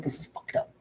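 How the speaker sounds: whispered aside, no voice; imitating a hushed, whispered remark; mock whisper. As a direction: hush it like a confession between friends. Imagined men hugging it out.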